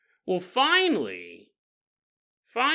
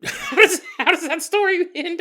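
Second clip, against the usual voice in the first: high, loud question through laughter; amused laughing; 13 semitones higher, 7 dB louder than usual. Real, not acted. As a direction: laugh while asking. Jokes about nursery rhyme, laughing.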